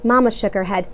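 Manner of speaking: ordinary delivery, nothing marked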